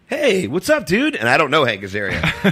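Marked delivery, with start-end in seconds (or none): 0.1-1.1 high-pitched imitation of an overjoyed hello; delighted greeting. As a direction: act thrilled to bump into an old friend. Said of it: "he said it like, you know, I'm so happy to see you".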